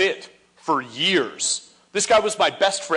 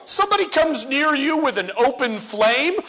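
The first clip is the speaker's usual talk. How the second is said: ordinary talk, nothing marked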